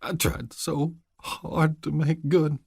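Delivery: soft, slow, choking grief; choked words. A narrator's reading. Son choking out his regret to his mother. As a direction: let the words catch. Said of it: "He choked on the words".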